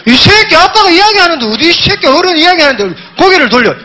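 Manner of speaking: hammed-up rage at a pet that turned its head; mock angry scolding. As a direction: owner scolds cat for looking away, mock fury. Dad scolds cat for turning away.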